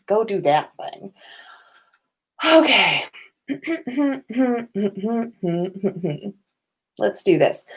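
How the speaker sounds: usual speaking voice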